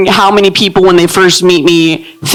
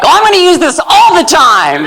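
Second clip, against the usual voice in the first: high chirpy enthusiasm; high bubbly mock excitement; mock excited; 9 semitones higher than usual. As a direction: gush like a new buyer. Excited new purchase voice.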